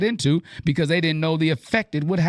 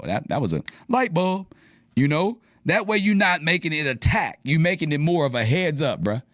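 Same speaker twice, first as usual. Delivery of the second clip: normal talking voice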